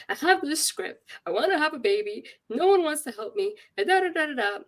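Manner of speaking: high-pitched venting, petering out in da-das; retold distraught rant. Distraught patient explaining the script to doctor, retold rant. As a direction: re-enact pleading that nobody will help. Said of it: "And I'm crying".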